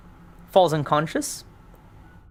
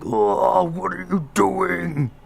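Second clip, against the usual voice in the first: higher, louder furious shout at his men; mad yell; 6 semitones higher, 3 dB louder, slower than usual. Acted. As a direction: bark the order in a rage, slow on the question. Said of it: "he's yelling, he's mad".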